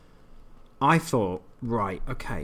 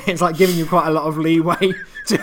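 laughing; 7 semitones higher, 12 dB louder than usual. Real, not acted. Laughing at the game's leeway.

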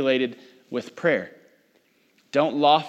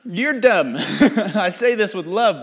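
higher, laughing and louder; laughing scold; 7 semitones higher, 3 dB louder, slower than usual. Real, not acted. Teasing the congregation about dumb fasting, laughing. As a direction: tease through laughter.

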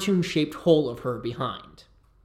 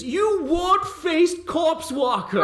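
a much higher, slow, sneering mage voice; insulting mage; 14 semitones higher, 3 dB louder, slower than usual. Acted. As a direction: spit the insult like a pompous wizard. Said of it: "points a finger at you and says".

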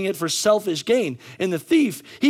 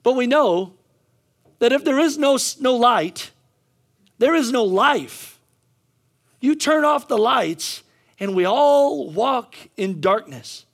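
raised, deliberate sermon voice; emphatic preaching; 5 semitones higher, 4 dB louder, slower than usual. Real, not acted. Preacher warning of walking in darkness, emphatic. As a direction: stretch the key words and pause between phrases.

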